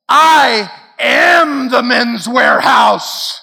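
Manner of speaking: I am the Men's Wearhouse shouted slow, near tears; defiant shout. Retold or imitated. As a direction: hurl it out like a wounded founder. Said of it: "look around and say".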